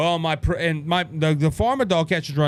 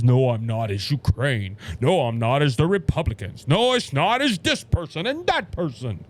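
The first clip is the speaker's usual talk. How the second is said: mocking Joe Biden; 6 semitones lower than usual. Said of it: "God forbid that we go ahead and say Joe Biden is at fault".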